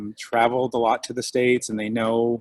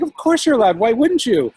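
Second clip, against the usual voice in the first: excited insistence; 10 semitones higher, 6 dB louder than usual. Retold or imitated. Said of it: "And I'm like".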